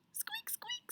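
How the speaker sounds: tiny, very high, soft squeak; squeaking mouse. Acted. Mouse answers Cow in a story, squeaking. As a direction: squeak faintly like a little mouse. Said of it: "said Mouse".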